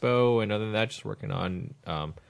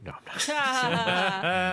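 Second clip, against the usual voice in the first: laughing denial; 10 semitones higher, much slower than usual. Real, not acted. Jokingly denying her stated age.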